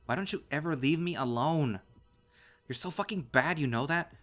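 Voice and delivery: loud, high-pitched exasperated yelling; enraged youngster at breaking point; furious outburst. A narrator's reading. Son lashes out at father.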